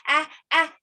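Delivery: building up to a sneeze, breath catching; a hammed-up sneeze coming on; about to sneeze. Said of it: "I've got the sneezies today".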